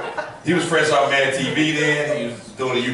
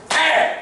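startled yell; 3 dB louder than usual. Retold or imitated. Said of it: "Samuel L. Jackson's character just went".

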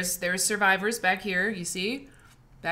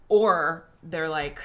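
usual speaking voice

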